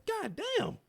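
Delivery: high, squealing mock outburst; a high, overdone, disbelieving cry of "God damn"; mock exasperation. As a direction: shriek it like you can't believe it. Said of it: "I'm like".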